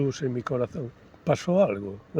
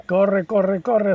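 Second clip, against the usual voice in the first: ordinary talk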